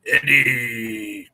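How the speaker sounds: gruff toy villain voice; low growl of a Kang figure; gruff tinny action figure. Pretend. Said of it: "That's what he sounds like in my head".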